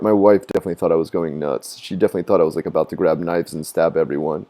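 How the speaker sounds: usual speaking voice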